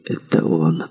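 low, hushed recognition; whispered. A narrator's reading. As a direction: murmur it under your breath. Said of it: "прошептал Винтер".

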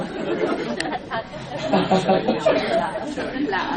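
a soft, airy laugh with little voice; breathy laugh. Real, not acted. Monk laughing at the disciple's words, an airy laugh.

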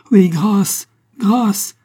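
high, loud screamed plea; terrified howl. A narrator's reading. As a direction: cry out for mercy at the top of the voice. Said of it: "hurla l'assassin".

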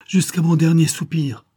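high, loud pledge of devotion; impassioned acted promise; fervent vow. A narrator's reading. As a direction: swear it ardently, voice lifted. Ardent vow to defend her.

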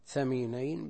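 ثمينين with TH as in "think". Shown as a demonstration. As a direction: start ثمينين on TH in place of S. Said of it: "بالمثلثة بدل السين". (ثمينين بالثاء بدل السين)

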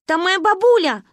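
put-on high, loud frantic child; panicked. A narrator's reading. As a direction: add fuel in alarm. Panicked phone call. (паника)

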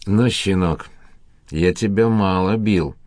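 ordinary delivery

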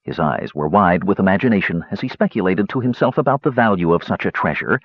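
ordinary delivery, nothing marked

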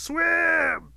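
high shout fading to a singsong farewell; high holler dropping away in volume; calling after someone. A narrator's reading. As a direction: start yelling, then ease off as they leave. Rabbit calling after departing Otter.

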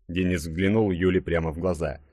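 plain reading voice

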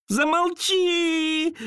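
high, drawn-out frightened shriek. Acted. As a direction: scream it in terror, stretched out. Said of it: "заверещала Мэри". (пронзительный визг)

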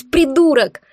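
"придурок" screamed high and loud, falling at the end; furious yell. A narrator's reading. Woman screaming "придурок" at Dar in fury. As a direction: spit out "придурок" at full volume. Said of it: "В праведном бешенстве заорала я".

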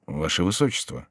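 softened voice, meant for one ear; confidential murmur. A narrator's reading. Gentleman into the duke's ear, speaking softly. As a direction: say it discreetly in the ear. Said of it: "шепнул Бюсси на ухо герцогу".

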